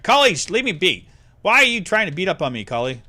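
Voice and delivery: loud, exasperated scolding; scolding the dog. Real, not acted. Scolding pet mid-stream.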